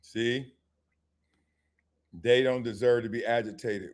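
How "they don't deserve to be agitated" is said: mocking echo. Echoing the pundit's words about the players, low and mocking. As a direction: repeat it back low and skeptical.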